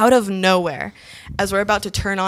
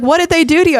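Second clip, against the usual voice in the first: high, loud, baffled question; raised-voice bewilderment; exasperated brother; 9 semitones higher, 6 dB louder, much faster than usual. Retold or imitated. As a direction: imitate a brother demanding an explanation. Brother questions her sudden fury.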